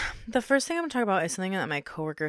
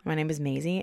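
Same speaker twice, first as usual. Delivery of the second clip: ordinary delivery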